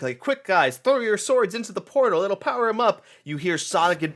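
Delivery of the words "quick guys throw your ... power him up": higher, louder urgent call; rally the knights in a high voice; higher, louder urgent command; urgent lady voice. Retold or imitated. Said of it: "the lady's like".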